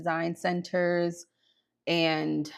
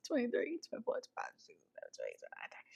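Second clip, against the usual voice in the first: very soft, fading to a whisper; counting under breath; 6 semitones higher, 13 dB quieter, much faster than usual. Real, not acted. Host working out how many nights remain, whispered counting. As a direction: count it off privately, almost without voice.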